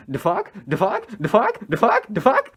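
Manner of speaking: escalating mock bewilderment; looping tripping friend. Imitates friend stuck in a loop.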